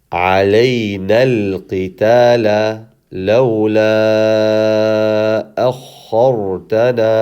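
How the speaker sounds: measured, chanted tajweed recitation; Quran recitation. Acted. Teacher recites the verse for the class in chant.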